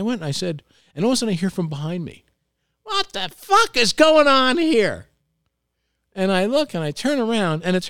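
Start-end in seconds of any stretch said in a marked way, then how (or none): 2.8-5.1 high, loud, slow indignant woman's yell; woman back from the bathroom, high and indignant. Retold or imitated. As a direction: play her furious and offended, drawn out. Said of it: "being indignant".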